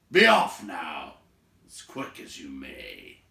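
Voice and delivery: the great host warning guests, slow and heavy, chuckling; gruff Beorn. Acted. Beorn sending the dwarves off, slow and booming.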